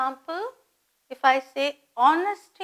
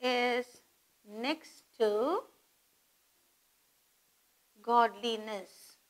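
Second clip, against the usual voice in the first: softer, in pieces with long pauses; slow dictation; 7 dB quieter, slower than usual. Shown as a demonstration. Teacher dictating a proverb for students to copy, slow dictation. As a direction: dictate it slowly for note-taking. Dictating the second example slowly.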